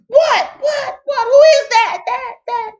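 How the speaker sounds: high, startled mock cry of what. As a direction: spin round at a voice behind you. Saul startled by hidden voice.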